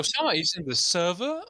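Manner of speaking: high, loud disbelieving question; surprised. Real, not acted. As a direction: act amazed someone showed up. Player joins, mock amazement.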